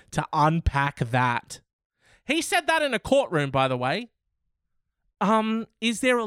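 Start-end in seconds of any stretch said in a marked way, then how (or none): 2.3-3.5 high, fast, outraged; outraged disbelief. Real, not acted. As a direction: spit it out sky-high in disbelief. Outraged aside.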